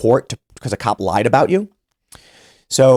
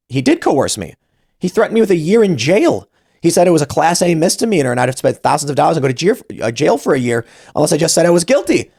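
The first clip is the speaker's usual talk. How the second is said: indignant rant; 6 semitones higher than usual. Retold or imitated. Venting at home after court.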